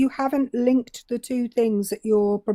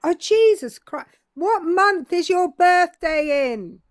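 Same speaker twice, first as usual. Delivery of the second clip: higher, exasperated and over-clear; real exasperation at not being heard; exasperated repeat; 4 semitones higher than usual. Real, not acted. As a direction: repeat it with raised pitch, fed up.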